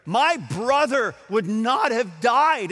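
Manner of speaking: sobbing accusation. Retold or imitated. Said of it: "in the sobs of her voice".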